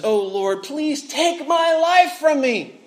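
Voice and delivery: slow, high, hammed-up plea to die; melodramatic plea. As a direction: overplay the despair, mock tragic. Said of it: "Jonah's a little bit melodramatic".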